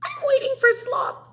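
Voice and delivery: very high, loud squealing hog; a squeaky pig demanding its meal. Acted. Teacher voicing the hungry hog for her class, in a very high squeal. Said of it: "who began to squeal".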